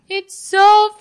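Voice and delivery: high, loud yell of the name; yell across food court. Retold or imitated. Friend yelling her name across the food court, loud. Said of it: "Yell across the food court".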